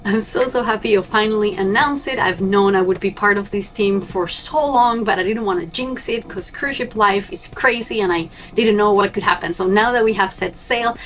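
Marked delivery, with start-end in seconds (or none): none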